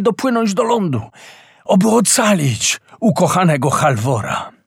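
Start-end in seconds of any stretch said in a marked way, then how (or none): none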